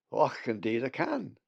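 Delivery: put-on a jolly old Scots bird greeting the lad; Scottish brogue. A narrator's reading. Bugs the raven speaks.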